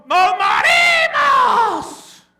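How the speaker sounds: desperate shout. Acted. Disciples screaming in the storm, drawn-out desperate shout. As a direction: cry out like the boat is going down. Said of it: "gritando en desesperación".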